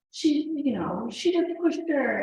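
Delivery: flustered father explaining; upset dad. Retold or imitated. Husband reporting to mom.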